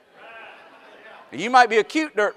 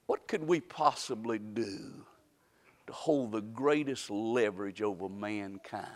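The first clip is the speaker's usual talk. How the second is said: softer, slow, near whisper; hushed storytelling; 4 semitones lower, 6 dB quieter, slower than usual. Acted. Preacher hushing the church to tell of God's council, slow and soft. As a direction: draw them in with a hush.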